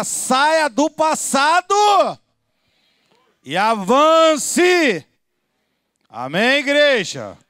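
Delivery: loud, drawn-out pulpit cries; prophesy over the church, stretching key words; soaring, slow shouted exhortation; prophetic preaching. Real, not acted. Prophesies at full force.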